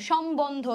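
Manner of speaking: সম্বন্ধ as shom-bondho, b sounded; spelled-out pronunciation. Shown as a demonstration. Teacher puts the syllables together, spelled-out pronunciation. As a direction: join the parts of সম্বন্ধ, keeping the b clear. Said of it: "এভাবে হচ্ছে এটার উচ্চারণ".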